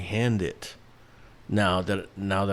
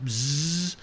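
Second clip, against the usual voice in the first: long, higher-pitched imitated electric buzz; bug-zapper buzz; 5 semitones higher than usual. Imitating a bug frying in the pool-party zapper, sustained buzz. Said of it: "hear one fucking bug just getting zapped".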